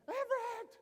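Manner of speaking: name shrieked very high and loud; a mother shrieking her son's name in terror; panicked scream. Retold or imitated. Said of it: "it was her screaming his name".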